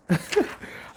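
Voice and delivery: short high breathy laugh; amused laugh. Real, not acted. Debater amused by one guy's ten rules, laughing. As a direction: burst out in a brief laugh.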